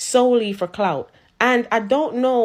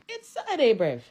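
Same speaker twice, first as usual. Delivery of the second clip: exasperated; 4 dB quieter than usual. Real, not acted. Exasperated aside.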